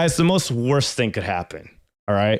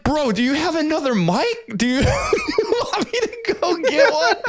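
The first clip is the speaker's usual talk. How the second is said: high, half-laughing panic; mock desperate, laughing through it; frantic plea. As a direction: beg for another mic, voice up high. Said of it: "I'm like".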